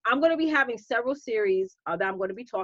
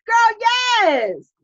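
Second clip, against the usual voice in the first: excited cheer; 11 semitones higher, 9 dB louder than usual. Real, not acted. Cheering a viewer comment.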